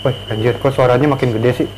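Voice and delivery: normal talking voice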